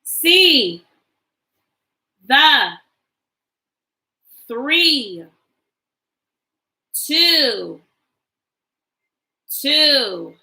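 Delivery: put-on slow, clear model reading for kids to echo. Shown as a demonstration. Teacher reading sight words aloud for the class to repeat, slow and clear. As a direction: say each word alone, then pause. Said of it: "let's read our sight words again".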